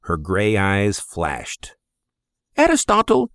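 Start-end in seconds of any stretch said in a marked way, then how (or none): 2.6-3.3 high, sharp mother's scold. Acted. Mother scolds her son, calling his name high. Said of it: "she scolded".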